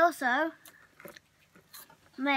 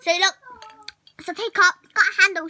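posh show-off voice; 3 semitones higher, 6 dB louder, faster than usual. Pretend. Mock posh teacup show.

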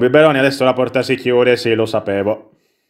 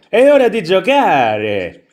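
lightly acted, higher, drawn-out call to play; prince's line; 6 semitones higher, slower than usual. Prince inviting the rabbit to play, higher sing-out. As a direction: read the prince aloud with a slow lilt. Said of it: "il principe ha detto".

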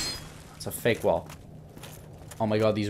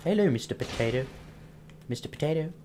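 ordinary delivery

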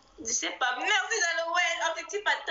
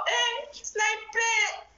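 drawn-out call; 8 semitones higher, much slower than usual. Real, not acted. Urging the team during live.

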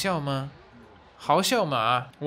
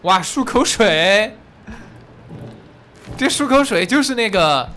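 loud, slow, stretched wow of delight; thrilled shout at a discovery; excited wow; 7 semitones higher, 8 dB louder than usual. Real, not acted. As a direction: gasp it out like a real find. Spots mouthwash in game.

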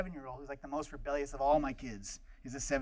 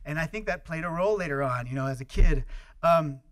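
usual speaking voice

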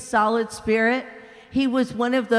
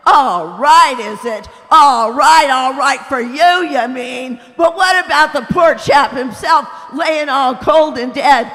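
big ghost, indignant; 3 semitones higher, 10 dB louder than usual. Acted. Big ghost shouting at the bright spirit, loud and outraged. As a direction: bellow it like an aggrieved bully.

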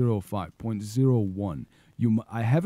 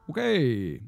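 normal talking voice

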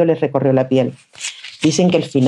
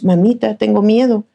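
higher, frightened little voice; scared child; 6 semitones higher than usual. A narrator's reading. Little girl telling her mother she is afraid. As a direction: whimper to mama for comfort.

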